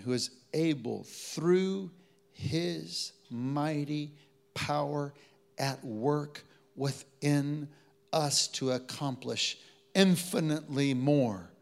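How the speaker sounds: slow, spaced-out reading; measured scripture reading. Real, not acted. Pastor reads scripture to the congregation, slow and measured. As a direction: read it slowly, word by word.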